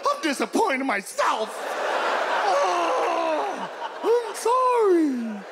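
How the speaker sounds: high, wailing mock apology; over-the-top contrite howl; remorseful dog. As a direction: wail like a guilt-ridden dog, dragging out the words. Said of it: "Dogs feel bad when they kill".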